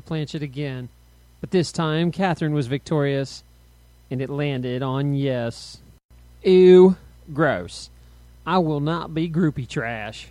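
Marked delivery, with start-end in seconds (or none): 6.4-10.3 very loud "Ew", then firm protest; disgusted shout. A narrator's reading. Rita protesting at the board, shouting in disgust. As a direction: burst out in disgust, then settle into refusal. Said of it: "Rita shouted at the board".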